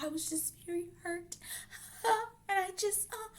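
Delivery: mock fake crying. Mocking the girl trying to cry, whiny mock sob voice. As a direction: mimic her fake tears. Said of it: "trying to cry".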